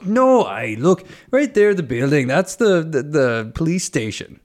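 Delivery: high, emphatic pointing-it-out voice; insistent bus driver. Retold or imitated. Bus driver showing the tourist the police station, animated insistence. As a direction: act out an animated local, pitched high. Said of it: "he goes".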